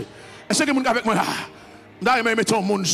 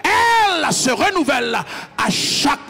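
loud, rising sermon cadence; full-force sermon voice; fiery preaching; 5 semitones higher, 4 dB louder than usual. Acted. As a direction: preach it out to the congregation.

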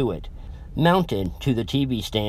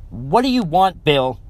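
higher, louder snap; snapped; 6 semitones higher, 4 dB louder than usual. A narrator's reading. Tearful girlfriend snapping at her boyfriend, curt snap. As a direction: bite the words off. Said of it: "she snapped".

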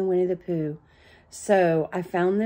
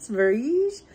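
French-accented, higher with rising end; 5 semitones higher, 3 dB louder than usual. Real, not acted. Trying the Swedish word for Sweden, French accent. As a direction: say "Sverige" as if it were French. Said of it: "saying it with a French accent".

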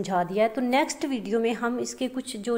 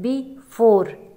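slow "बी four" with "four" hit louder; stress on second syllable; 6 dB louder, much slower than usual. Shown as a demonstration. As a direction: draw it out and lean hard on "four" after a lighter "बी". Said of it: "उस पे ज़्यादा stress है". ("बी four" में "four" पर ज़्यादा ज़ोर, धीरे-धीरे)

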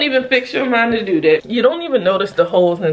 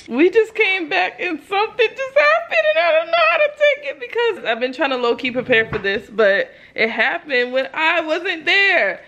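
excited; 8 semitones higher than usual. Real, not acted. Excited news after coming home.

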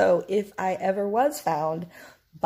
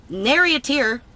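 mocking jab; 5 semitones higher, 7 dB louder than usual. Real, not acted. Mocking the roommate's fake crying.

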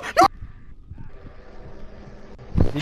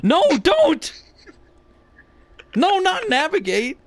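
shouting at voice assistant; 12 semitones higher, 3 dB louder, slower than usual. Real, not acted.

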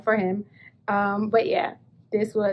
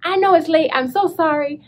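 higher, louder breathless gush; excited retelling; 7 semitones higher, 5 dB louder than usual. Retold or imitated. Calling friend Teresa late, gushing excitedly. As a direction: pour it out to a friend. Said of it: "I was like".

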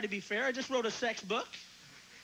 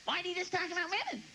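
higher, nagging woman's voice; a light mock of a woman's complaint; lightly voiced whiny woman; imitating a complaining woman; 5 semitones higher, faster than usual. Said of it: "Woman came up to me and goes".

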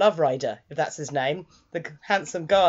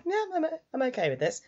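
high, soft, quick mock bashfulness; bashful acceptance; 7 semitones higher, 6 dB quieter, faster than usual. Boy accepting his best friend's kiss, imitated coyly. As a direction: play it small and coy.

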